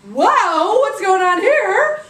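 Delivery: high, loud hammed-up amazement; astonished. Rich lady seeing baby revived, loud astonishment. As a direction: cry out slowly in shock.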